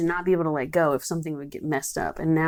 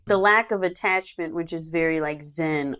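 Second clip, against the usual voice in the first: ordinary talk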